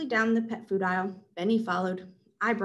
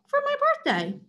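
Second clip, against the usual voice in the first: much higher hurt voice; squeaky protest. Acted.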